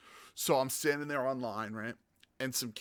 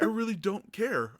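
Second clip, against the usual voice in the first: higher, louder, slow dismissive reply; telling the line-cutting kid off, louder and slow; annoyed retort; 10 semitones higher, 6 dB louder than usual. Retold or imitated. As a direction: drag it out, fed up.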